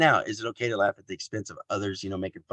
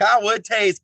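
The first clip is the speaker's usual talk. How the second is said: much higher, louder mock line; Robbie's voice; 12 semitones higher, 7 dB louder, slower than usual. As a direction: squeal it out as a teasing kid. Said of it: "Robbie's like".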